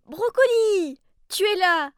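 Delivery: high loud happy cry; relieved joy at finding him; excited. Acted. Finds the dog.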